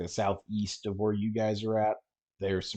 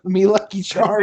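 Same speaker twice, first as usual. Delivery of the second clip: leprechaun; 12 semitones higher, 13 dB louder than usual. Pretend. Leprechaun joke, loud brogue.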